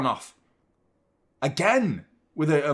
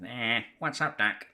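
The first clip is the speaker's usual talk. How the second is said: lightly voiced Bugs Bunny, softer; a hint of the cartoon rabbit; 4 semitones lower, 5 dB quieter than usual. Naming cartoon characters, a light Bugs Bunny. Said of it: "Bugs Bunny".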